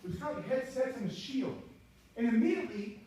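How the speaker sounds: ordinary talk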